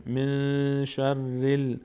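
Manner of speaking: "من شر ریل" slow, syllables linked with held nasal n; joined syllable demo. Shown as a demonstration. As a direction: stretch "من شر ریل" as in recitation. Teacher models joined syllables slowly.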